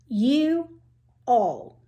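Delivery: "You" and "all" said as two separate words; split into two words. Acted. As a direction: pause between "You" and "all". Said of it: "That's two words".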